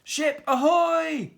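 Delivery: high, loud, drawn-out call; pirate spotting a ship far off; shouted from crow's nest. A narrator's reading. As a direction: hail it from up the mast. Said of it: "shouted from the crow's nest".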